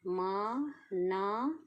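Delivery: मा ना drawn out very slowly, each syllable held long; syllables stretched out. Shown as a demonstration. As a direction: sound out मा ना slowly while writing the outline. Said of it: "तो हमें इसे भी ऊपर से शुरू करना है". (मा ना बहुत धीरे, हर अक्षर खींचकर)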